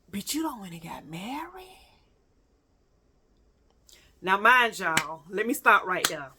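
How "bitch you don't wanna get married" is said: hushed whisper. Retold or imitated. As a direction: drop to a breathy secret. Sudden aside to ex.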